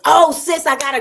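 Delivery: high, loud, eager oh sis; excited exclamation. Real, not acted. Vlogger excitedly tells her friend about a scenario, high and loud. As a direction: burst out with news.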